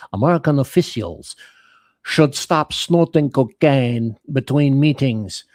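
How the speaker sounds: mock the French general's accent. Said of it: "I'm gonna do my best frog voice here".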